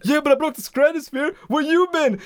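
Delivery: high-pitched indignant comeback; mock outrage, voice shot way up; retold angry yelling. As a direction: imitate a man arguing with kids. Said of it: "he starts yelling at and getting into it with kids".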